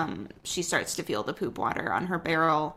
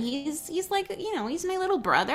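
higher voice; 10 semitones higher, faster than usual. Acted. Character voice higher, rushed.

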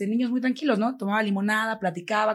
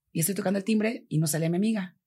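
ordinary talk, nothing marked

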